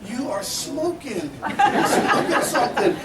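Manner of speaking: slow, high-pitched mock accusation; stretched-out teasing incredulity; comic disbelief. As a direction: deliver a drawn-out, amused verdict. Retold amused verdict on colleague.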